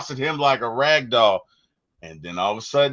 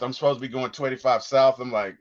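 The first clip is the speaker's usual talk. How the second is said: ordinary delivery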